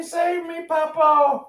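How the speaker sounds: high playful plea; a high mock cry to be saved; fluttering cries for help.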